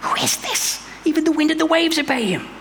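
high, terrified whisper breaking into awe. Acted. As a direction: gasp it out, scared of what you just saw. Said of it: "They were terrified and asked each other".

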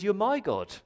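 MY lifted high in "You're my God"; stress on MY. Shown as a demonstration. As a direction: lean on the word MY. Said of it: "don't miss that word "my".

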